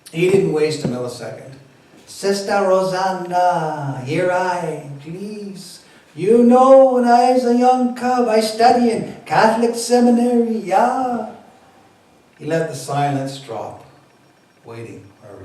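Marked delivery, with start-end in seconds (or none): 2.1-11.3 high, loud Jamaican Rasta storytelling voice; Rastafari accent. Acted. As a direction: win her over in Rasta voice. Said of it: "my Rastafari accent".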